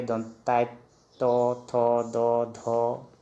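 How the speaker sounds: "तो, थो, दो, धो" each with a held o; Assamese letter recitation. Shown as a demonstration. Teacher showing how Assamese is read, measured letter recitation. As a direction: recite "दंताय तो, थो, दो, धो" as a measured row. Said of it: "जैसे असमिया में हम पढ़ेंगे".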